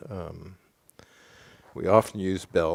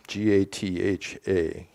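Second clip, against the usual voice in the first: slow, deliberate letter-by-letter spelling; slow spelling aloud for listeners; spelled out. Shown as a demonstration. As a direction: spell it out slowly, each letter separate.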